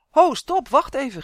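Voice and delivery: very high, loud shriek to stop the game; alarmed shout across the football field. Acted. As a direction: yell at the others to stop playing. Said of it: "gilde Rodi".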